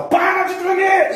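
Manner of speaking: high, loud, drawn-out mock order; hammed-up exasperated shout; acted shouted command. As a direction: yell at your own body to obey. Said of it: "Você fala".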